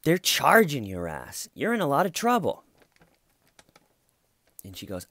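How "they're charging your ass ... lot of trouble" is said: relishing his line. Retold or imitated. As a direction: savor each word like the husband did. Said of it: "I love the way he says it".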